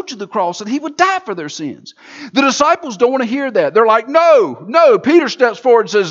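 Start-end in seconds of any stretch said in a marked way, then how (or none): 4.1-5.0 louder and higher protest. Acted. Disciples refusing Jesus's words, loud protest. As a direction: push back hard.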